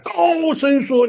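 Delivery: high, drawn-out proclamation. Retold or imitated. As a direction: stretch it out high like a rallying call.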